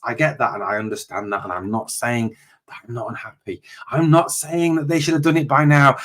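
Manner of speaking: ordinary talk, nothing marked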